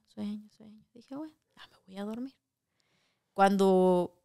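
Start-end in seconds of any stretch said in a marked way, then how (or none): 0.1-2.3 sleepy. Retold or imitated. Drifting off to sleep.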